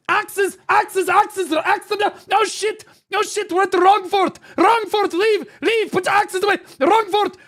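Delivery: high, loud panicked yelling; frantic mock callout. Streamer mocks his shot caller's panicked orders, yelling. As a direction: scream orders at the guild in a panic.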